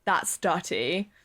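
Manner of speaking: slow, sneering posh British schoolgirl; mock posh British disdain. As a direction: sniff at it like a posh British TikTok girl. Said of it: "posh girls on TikTok are like".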